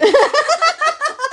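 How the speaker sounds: high, loud burst of laughter. Real, not acted. Cracking up at co-host's mishearing, high loud laughter.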